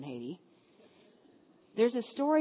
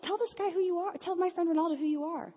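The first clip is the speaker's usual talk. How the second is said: higher, louder insistent call; calling to Bill; 11 semitones higher, 4 dB louder than usual. Retold or imitated. Telling her friend Bill to introduce himself, loud and high. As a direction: insist loudly across the group. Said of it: "I'm like".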